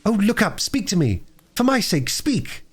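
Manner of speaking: put-on high-pitched children's plea in short bursts; earnest pleading. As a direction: beg him urgently in a child's high voice. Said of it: "as earnestly as they could".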